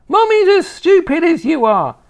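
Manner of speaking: high, loud insult; contemptuous old grandma. Acted. Grandma insulting the boy and his mummy, contemptuous.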